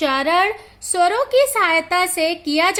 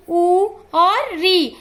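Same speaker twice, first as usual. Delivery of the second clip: slow recitation; much slower than usual. Shown as a demonstration. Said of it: "इसकी संख्या चार होती है।".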